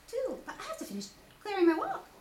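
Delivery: high, fast little pig; hurried squeaky fret; Piglet voice. Acted. Piglet explaining his chores, fast and high.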